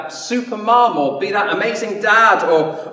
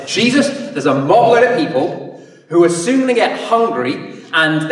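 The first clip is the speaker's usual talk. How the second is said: louder, pushy, exasperated plea; fed-up disciples; 2 semitones lower, 4 dB louder than usual. Retold or imitated. Disciple impatiently urging Jesus to get rid of the hungry crowd, loud. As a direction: push Jesus to send them off now. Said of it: "I reckon they were more like this".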